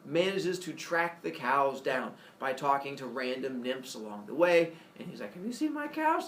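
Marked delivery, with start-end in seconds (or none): 5.3-6.3 high asking Apollo. Acted. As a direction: ask around, searching. Apollo asks nymphs.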